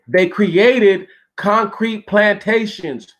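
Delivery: "created concrete plantations" drawn out slowly at a high pitch; drawn-out emphasis. Real, not acted. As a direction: spell out "concrete plantations" word by word, high and forceful. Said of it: "do I have to say it like that".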